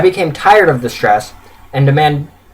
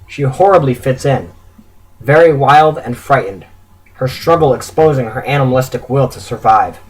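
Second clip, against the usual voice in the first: plain reading voice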